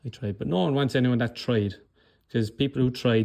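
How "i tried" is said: soft, limp excuse voice, trailing off; a mock victim's deflated excuse; defeated whine. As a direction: sound like you've already given up. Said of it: "you even hear it in their voice".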